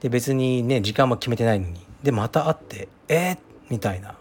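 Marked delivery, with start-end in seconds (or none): 3.1-3.4 high, questioning "eh?" of disbelief; surprised. Retold or imitated. Retelling a chance reunion on the train, surprised. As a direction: re-enact a startled double take, pitch jumping up.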